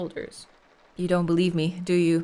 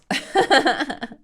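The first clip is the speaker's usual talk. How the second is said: hearty laugh; 6 semitones higher, 6 dB louder than usual. Acted. Officer laughs at the ghost joke.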